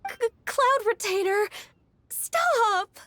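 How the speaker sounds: high, embarrassed cry to make her stop; mortified, pleading to end the topic; flustered protest. Acted. Embarrassed by her childhood story.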